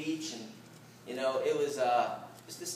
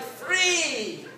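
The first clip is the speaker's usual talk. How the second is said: high joyful bird cry of release; a laughing, happy whoop; seagull joy; 11 semitones higher, 9 dB louder than usual. Retold or imitated. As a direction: cry out like a gull set loose. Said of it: "joy, okay?".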